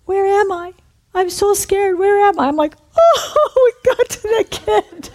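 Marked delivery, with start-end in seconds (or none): 0.0-2.5 high-pitched imitation of a small child; little scared voice. Hurt child part of [name] wakes up, high little voice. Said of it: "this little voice comes out of me". 3.0-5.1 very high, squealed gasp with laughter; mock amazement. Ministers realize they reached the child, high amazed squeal.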